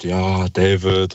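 very slow, drawn-out fed-up answer; retold weary reply to a phone bot, words dragged out; really annoyed. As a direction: stretch it out, mock-irritated. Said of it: "richtig genervt geantwortet".

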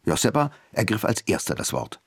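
plain reading voice

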